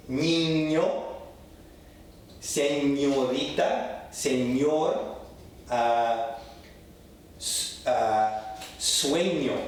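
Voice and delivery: "niño", "señorita", "sueño" each with nyo; careful ñ in each word; Spanish ñ. Shown as a demonstration. Teacher running through ñ examples.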